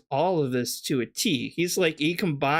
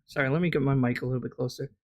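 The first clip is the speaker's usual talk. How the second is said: quick aside; 5 semitones lower, 3 dB quieter, much faster than usual. Real, not acted. Quick aside about mic.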